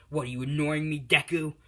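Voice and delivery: loud mock confrontation; acted angry bully. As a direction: demand it like an offended bully. Bully confronts boy he pulled down.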